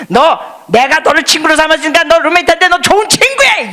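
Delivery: loud, high mock command; hammed-up bossy shout; mock bossy order. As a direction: bark it as the wrong way. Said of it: "이러지 않으시고".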